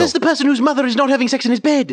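loud, high, indignant retort; indignant outburst. Acted. Rory snapping back at Jordan, loud and indignant. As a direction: raise your voice in indignant protest.

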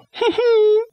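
very high, put-on giggle; falsetto giggle. Giggle as Ophelia.

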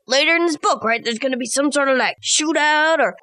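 ordinary delivery

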